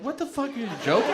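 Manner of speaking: high, fast exasperated nurse; hospital staff mock outrage; incredulous nurse. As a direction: snap it back in disbelief. Nurses angry at joke.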